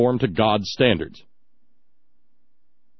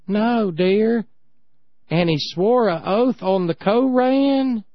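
higher, slow homespun wife voice; folksy wife; 6 semitones higher, slower than usual. Retold or imitated. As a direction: answer your husband with higher, slow folksy surprise. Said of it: "Emory looks at Eileen and says".

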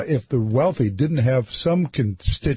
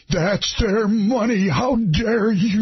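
higher, slow mock-outraged caller; hammed-up fury, pitched up and stretched; imitating shaking anger; 8 semitones higher, slower than usual. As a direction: draw it out, quaking with put-on indignation. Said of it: "shaking with anger".

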